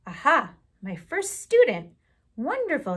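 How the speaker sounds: a lightly voiced chirpy little bird, lilting; sing-song bird. Little yellow bird greeting her first student, lightly sing-song. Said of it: "she sang".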